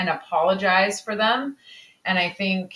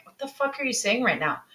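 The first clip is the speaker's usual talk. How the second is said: usual speaking voice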